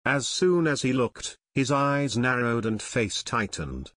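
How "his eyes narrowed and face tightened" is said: plain reading voice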